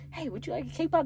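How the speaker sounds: high, bright offer; friendly offer. Retold or imitated. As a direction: sing it out pitched high. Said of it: "I was like".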